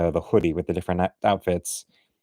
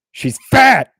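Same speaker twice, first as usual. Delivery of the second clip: exaggerated squawk, far above usual pitch; mock outburst; 8 dB louder than usual. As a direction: quote it with a sudden loud, high-pitched yell. Mock-quoting a comment about [name].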